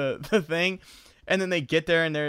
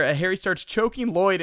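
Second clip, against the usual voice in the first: ordinary talk, nothing marked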